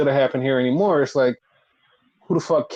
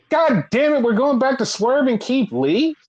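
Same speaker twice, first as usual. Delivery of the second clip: exasperated; 7 semitones higher than usual. Retold or imitated. Own exasperated reaction to stale storyline.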